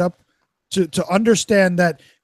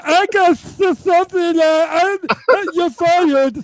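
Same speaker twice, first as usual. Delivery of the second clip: higher, slow lisping Trump parody; mocking bad Trump; 9 semitones higher, 4 dB louder, slower than usual. Host mimics the comic's awful Trump, higher and lisping. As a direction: drag out the catchphrase with a lisp. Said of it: "you know what his Trump sounded like".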